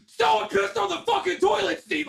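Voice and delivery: very high-pitched mock yell; imitated yelling. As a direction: holler it like an outraged coworker, high and strained. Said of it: "he would fucking yell some of the most wild shit".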